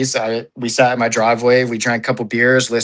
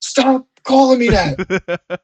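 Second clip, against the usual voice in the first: high, slow whining complaint; whiny high voice; 13 semitones higher, slower than usual. Pretend. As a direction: whine it like an annoyed kid. Voicing teammate complaining.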